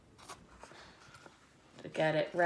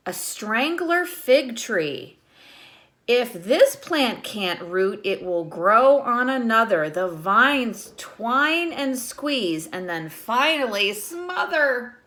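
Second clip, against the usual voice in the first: lightly lilting read-aloud; reading a picture book to children; 2 semitones higher, 7 dB louder, slower than usual. Acted. Teacher reading the book to her class, lightly lilting.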